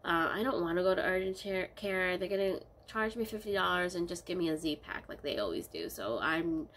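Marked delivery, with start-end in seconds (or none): none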